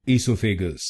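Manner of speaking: "esophagus" said wrongly as ee-SO-fe-ga, long ee and flat o; the common Indian mispronunciation "esophagus", ee-so-fe-ga; wrong pronunciation. Shown as a demonstration. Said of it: "विभिन्न गलत pronunciation सुनेंगे".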